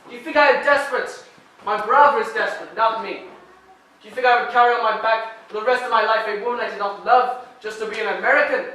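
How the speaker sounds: loud, indignant and wounded; angry tirade. Acted. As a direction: hammer each question home.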